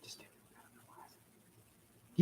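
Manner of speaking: barely audible, drawn-out whisper. Real, not acted. Urging listener on after the wedgie tease, whispered. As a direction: whisper it very slowly.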